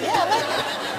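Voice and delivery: airy eager yes through laughter; breathy laughing yes. Retold or imitated. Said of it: "I said".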